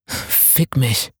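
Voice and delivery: höher, leiser, atemlos gekeucht. Acted. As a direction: zwischen zwei Atemzügen hervorgestoßen. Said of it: "keuchte er". (Dev keucht seinem Liebhaber in der Dusche zu, atemlos)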